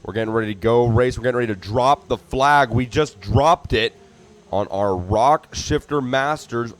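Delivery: booming trackside announcer hyping the main event; hyped race announcer. Acted. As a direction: call it out to the whole crowd.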